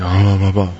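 low grumbling gibberish; grumpy man's mumbling. Retold or imitated. Husband grumbling at the yelling neighbor, mock low grumble. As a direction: imitate a grumper with low nonsense syllables. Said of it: "the guy was a grumper".

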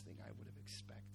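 very soft, low near-whisper; hushed reverence. Real, not acted. Preacher marvels after the brothers' reconciliation, hushed reverence. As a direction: let it sink to a hush.

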